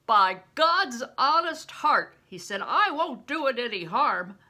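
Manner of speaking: loud, emphatic vow; swearing an oath. A narrator's reading. Bear swearing to Crispin he means no harm, loud. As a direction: swear it with force.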